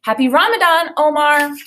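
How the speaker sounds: high, loud bubbly greeting; cheerful teacher. A narrator's reading. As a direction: bounce in like a chirpy teacher. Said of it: "bounced over to my table and said".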